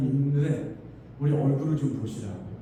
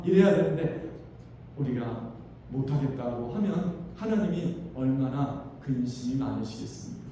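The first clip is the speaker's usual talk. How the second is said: normal talking voice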